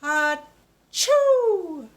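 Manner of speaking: loud, high mock sneeze; big sneeze. Shown as a demonstration. George the piglet sneezes in a story read to a child, loud. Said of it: "Let's say that word".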